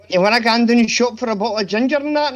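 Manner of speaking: thick Glaswegian Scots, loud; broad Glaswegian accent. Pretend. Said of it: "posh voice ... Glaswegian".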